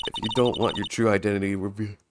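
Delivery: reading through a yawn; words stretched by a yawn. Real, not acted. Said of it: "Sorry, I was yawning".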